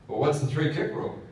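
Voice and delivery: put-on big lawyer loudly challenging the old sergeant; loud demand. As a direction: bark it as the big city lawyer.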